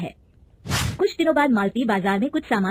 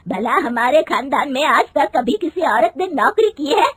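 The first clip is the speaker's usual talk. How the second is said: loud, higher indignant question; mother-in-law outraged at the job idea, loud; angry; 5 semitones higher, 6 dB louder than usual. Acted. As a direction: hurl it at her, outraged. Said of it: "गुस्से में कहती है".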